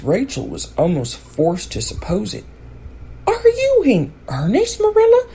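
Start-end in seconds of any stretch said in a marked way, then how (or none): 3.3-5.3 much higher, shocked disbelieving question; astonished demand. A narrator's reading. Rachel, stunned by the orphan news, demands in high shock. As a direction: gasp it out in disbelief. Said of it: "she demanded when voice returned".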